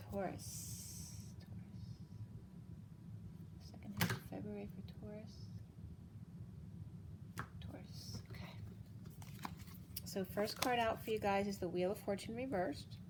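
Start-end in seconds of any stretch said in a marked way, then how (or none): 0.0-8.5 soft breathy whisper, slowed. Real, not acted. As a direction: murmur the name under your breath. Whispered calling while shuffling cards.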